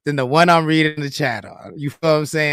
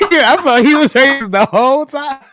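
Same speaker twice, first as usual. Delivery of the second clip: laughing through the words, higher and louder; 10 semitones higher, 6 dB louder than usual. Real, not acted. Host cracking up at his own Lethal Weapon joke, laughing. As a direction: giggle while you say it.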